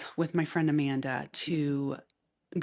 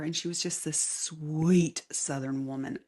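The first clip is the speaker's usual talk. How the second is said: ordinary talk, nothing marked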